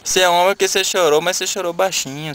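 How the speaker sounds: ordinary delivery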